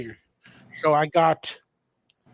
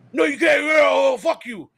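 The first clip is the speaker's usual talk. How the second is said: loud, angry shout from a bar fight; retold angry shout; 6 semitones higher, 5 dB louder than usual. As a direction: snap back hard at the drunk fan.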